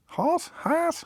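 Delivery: very high, questioning "Harse" with open ah; estuary vowel. Shown as a demonstration. Host testing estuary house, high-pitched questioning "Harse". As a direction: squeak "Harse" softly upward, trying it out. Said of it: "house becomes harse".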